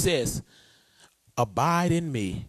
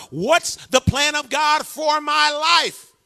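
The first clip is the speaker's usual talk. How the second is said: slow call to congregation; 9 semitones higher, 5 dB louder, slower than usual. Shown as a demonstration. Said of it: "One more time. Let's say it again".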